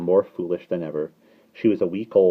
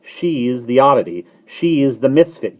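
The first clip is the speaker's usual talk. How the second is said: higher, emphatic declarations; each verdict pitched up and louder; declaiming; 7 semitones higher, 9 dB louder than usual. A narrator's reading. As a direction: build it line by line. Starling's derision in dialogue.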